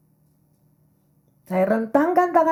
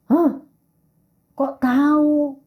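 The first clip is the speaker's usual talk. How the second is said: slow, higher astonished question; surprised; 5 semitones higher, slower than usual. Retold or imitated. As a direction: gasp then ask in disbelief. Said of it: "dia bilang".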